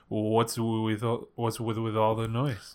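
slow, soft, stilted line reading; bad acting. Shown as a demonstration. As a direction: deliver it stiffly, dragging it out. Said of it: "But he delivers it like this".

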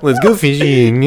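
slow, drawn-out mock impression; Mark Zuckerberg. Mocking a friend's impression, slow frat tech-boss voice. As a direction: mimic a tech founder in a frat. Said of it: "if Mark Zuckerberg was in a frat".

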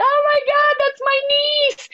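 high, proud squeal, slowing down; excited relatives. Retold or imitated. Family in Mexico bragging about her, high proud squeal. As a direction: gush like a proud aunt. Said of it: "My family in Mexico was like".

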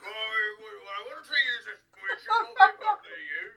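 high, loud, half-crying plea to Harry; pleading Hagrid-Boris. Acted. Hagrid-Boris pleading with Harry, high loud near-crying. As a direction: beg Harry with rising panic.

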